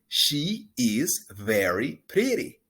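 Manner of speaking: slow, clear pronunciation model; slow model sentence. Shown as a demonstration. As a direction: spell it out slowly for repeating. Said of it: "Repita después de mí".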